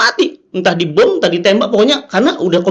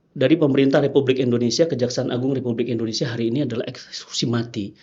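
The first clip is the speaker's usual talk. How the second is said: official reading aloud; 8 semitones lower, 6 dB quieter than usual. Retold or imitated. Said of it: "rupanya hari itu dibacakan".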